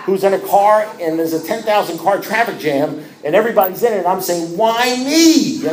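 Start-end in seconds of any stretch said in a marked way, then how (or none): 4.6-5.3 mock whine. Self-pitying whine in traffic.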